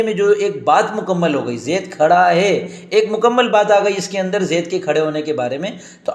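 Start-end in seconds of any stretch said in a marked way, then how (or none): 1.6-2.7 slow translation. Shown as a demonstration. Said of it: "زیدن قائمن کو ہم نے ملا دیا".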